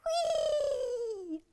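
thrilled high squeal; squealing with joy. Acted. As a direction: squeal down the slide. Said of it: "squealed Flip-Flop".

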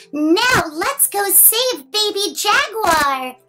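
ordinary delivery

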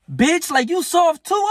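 mock outraged. Retelling his rage at the coach, high outraged squeal. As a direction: squeal it in disbelief. Angry retold reaction to coach.

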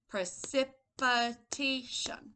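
Precipitation broken into slow, separate syllables. Shown as a demonstration. Teacher repeats Precipitation for the class, slowly in syllables. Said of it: "Can we say precipitation?".

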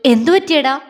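sudden loud worried question. A narrator's reading. Agni alarmed that Jwala is hurt, loud worried question. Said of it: "ആകുലതയോടെ ചോദിച്ചു".

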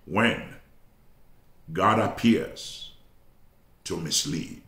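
long pauses between "when", "God appears" and "to mislead"; deliberate title announcement. Real, not acted. Preacher giving the congregation his sermon title, measured and pause-laden. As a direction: break the title up, dropping soft and low on "to mislead".

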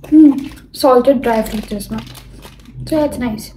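chewing between words; talking while chewing. Real, not acted. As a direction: mouth partly full. Seen 0: eats from packet at -2, chewing mouth through labelled words.

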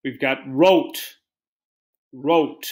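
ordinary talk

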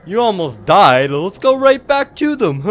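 high, loud sarcastic cheer; mock enthusiasm. Player mocks his own plan to return, fake enthusiasm. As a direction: cheerily pitch a terrible idea. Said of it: "here's a good idea".

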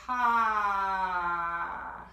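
one long loud breathy ha. Shown as a demonstration. Teacher demonstrates the long fire breath, sustained loud ha. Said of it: "we're gonna make a long ha sound".

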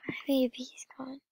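whispered quickly, almost to herself; fast whisper. Real, not acted. Vlogger repeating the loss to herself, in a quick whisper. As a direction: let it slip out as a whisper. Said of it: "so sad".

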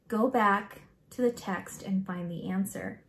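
plain reading voice

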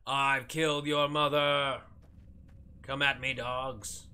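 loud, drawn-out hammed-up war cry; theatrical taunt, loud and slow; mock battle cry. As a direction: bellow a mock challenge at the enemy, stretching the words. Taunting enemy dogs in a game.

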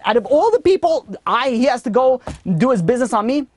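high-pitched, aggrieved mock protest; exasperated complaint. Sleepy traveler asking God why the dog chose him, exasperated. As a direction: protest it high, as the singled-out victim.

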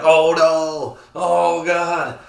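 loud, high, drawn-out dismay; hammed-up cry of alarm; mock horror. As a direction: wail it in exaggerated dread. Acting out his horrified reaction.

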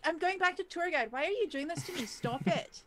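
loud, high-pitched playful complaint; mock protest. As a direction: protest at being teased, voice swooping up. Mock protest at being questioned.

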